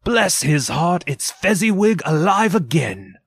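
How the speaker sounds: high, delighted exclamation; great excitement. A narrator's reading. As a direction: cry out in excited recognition. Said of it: "Scrooge cried in great excitement".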